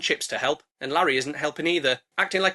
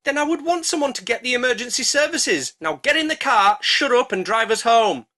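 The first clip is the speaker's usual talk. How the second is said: bossy order; 7 semitones higher, 5 dB louder than usual. Acted. Dish ordering brother home.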